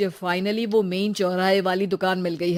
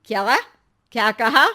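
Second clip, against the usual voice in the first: startled question; 2 semitones higher, 6 dB louder than usual. Acted. Mother startled by remark.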